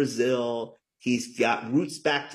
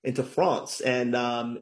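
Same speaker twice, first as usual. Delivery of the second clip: normal talking voice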